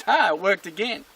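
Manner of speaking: pleased-with-itself dog voice; triumphant dog. Acted. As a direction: crow as a dog whose trick worked. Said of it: "And the dog goes".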